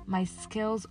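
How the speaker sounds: ordinary talk, nothing marked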